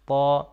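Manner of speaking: طا as a heavy, emphatic Arabic t; thick taa. Shown as a demonstration. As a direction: say طا alone with a thick, full sound. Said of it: "تو تھوڑا موٹا تلفظ کیا جائے گا".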